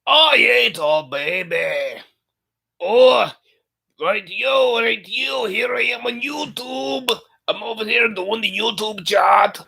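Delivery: hammed-up movie character impression, high and slow; Fat Bastard. Shown as a demonstration. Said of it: "I could try sounding Fat Bastard".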